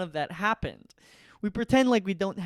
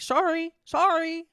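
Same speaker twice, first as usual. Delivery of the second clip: mock apology; 11 semitones higher, 5 dB louder than usual. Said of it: "mock apology".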